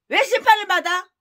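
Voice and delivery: ordinary talk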